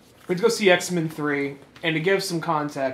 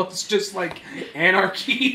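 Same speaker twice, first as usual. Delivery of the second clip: usual speaking voice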